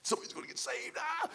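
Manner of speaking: breathy hushed eagerness, then a squeak; excited whisper. Retold or imitated. Friend at the ottoman hissing eagerly during the prayer. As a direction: whisper it like you can't wait. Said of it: "John Hansford's like".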